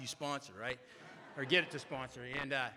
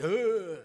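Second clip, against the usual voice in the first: loud, higher groan of dread; mock disgusted groan; 3 semitones higher, 8 dB louder than usual. AA member recoiling from a greeter's hug, disgusted groan.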